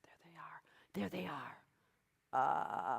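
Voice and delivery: slow whisper, no voice. Shown as a demonstration. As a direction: breathe it out softly. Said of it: "one way to find them is stiff".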